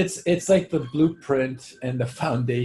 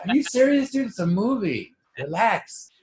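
high-pitched incredulous scolding, easing down; mock incredulous; 6 semitones higher than usual. Friend telling Scarface fans to relax, incredulous. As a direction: talk down an overexcited fan in disbelief.

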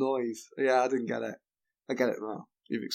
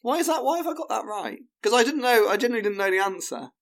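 high-pitched, incredulous questioning; baffled amazement; 9 semitones higher than usual. Real, not acted. Contestant questioning why the odd phrase made sense, baffled. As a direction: struggle to grasp it, voice well up. Baffled at own correct guess.

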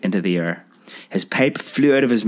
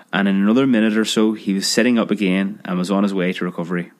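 ordinary delivery, nothing marked

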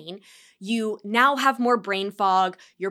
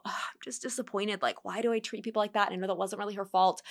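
a softer, whispered, quicker grumble; whispered self-reproach; 8 dB quieter, faster than usual. Acted. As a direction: hush it like a private thought. Voicing inner self-talk.

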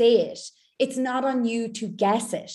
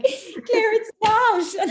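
high and laughing through it; laughing admission; 12 semitones higher, 5 dB louder, much slower than usual. Retold or imitated. Client laughing to coach Claire that it isn't working. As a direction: laugh as you confess it failed.

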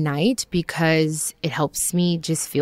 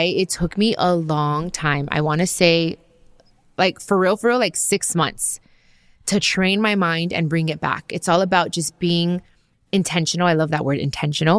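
ordinary talk

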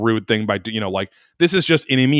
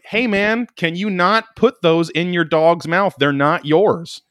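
mild raised-voice protest, drawn out; retold yelling; 7 semitones higher, slower than usual. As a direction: call out slowly and higher, a half-strength yell. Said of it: "And I'm yelling".

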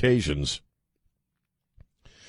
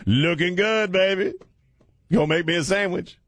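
mock catcall; 9 semitones higher than usual. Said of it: "like a female employee say".

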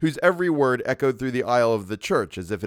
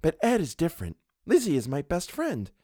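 put-on higher, lightly girlish voice; chattering young model; 7 semitones higher than usual. A narrator's reading. Model defending her friend's brother, in girlish chatter. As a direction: insist on it with girlish earnestness. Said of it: "she began to chatter".

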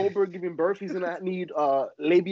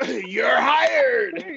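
wrestling promo; 7 semitones higher, 4 dB louder than usual. Retold or imitated. Vince impression punchline.

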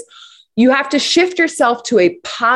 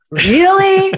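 "Really?" shot up high in mock exasperation; exaggerated disbelief; 10 semitones higher, 4 dB louder than usual. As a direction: stretch "Really?" way up like an exasperated parent. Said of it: "you say to your kids".

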